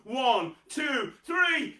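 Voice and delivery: host counting the audience in, building to a shout. Real, not acted. Counting kids into a cheer.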